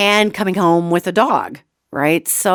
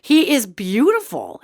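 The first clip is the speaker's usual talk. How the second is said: usual speaking voice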